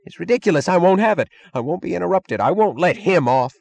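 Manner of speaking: high, loud, trembling tantrum; shaking hysteria. Acted. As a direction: rant shakily, losing control. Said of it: "He was shaking".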